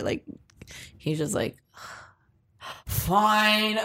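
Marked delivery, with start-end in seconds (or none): 2.9-3.9 reluctant fine. Retold or imitated. Doorman caving to her begging with a sigh. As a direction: sigh then a grudging fine.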